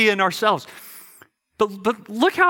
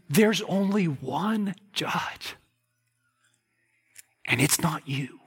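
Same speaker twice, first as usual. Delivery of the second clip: solemn whisper; 5 dB quieter, slower than usual. Acted. Solemn whispered point after outburst.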